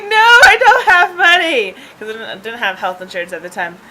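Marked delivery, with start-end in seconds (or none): 0.0-1.7 high, loud wailed protest; mock crying. Driver refusing an ambulance, mock crying wail. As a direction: refuse in exaggerated tearful panic. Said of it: "I just start crying".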